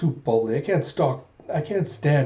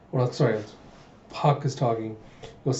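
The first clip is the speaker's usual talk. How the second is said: ordinary delivery, nothing marked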